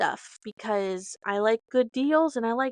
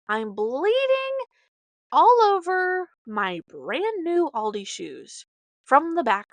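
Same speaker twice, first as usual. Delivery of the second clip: shocked outcry; 6 semitones higher, 5 dB louder than usual. Retold or imitated. Mom finding her heel bleeding on new shoes, shocked. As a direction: stretch it out in disbelief.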